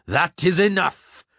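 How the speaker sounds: high, slow, indignant outburst; angry shout. A narrator's reading. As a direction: put a stop to it, drawing out each word. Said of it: "Zoltan shouted angrily".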